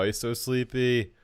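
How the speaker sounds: ordinary delivery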